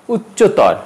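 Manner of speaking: উচ্চতর stopped on r; no vowel after উচ্চতর; wrong pronunciation. Shown as a demonstration. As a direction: say উচ্চতর as "uchcho-tawr". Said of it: "উচ্চতর হবে না".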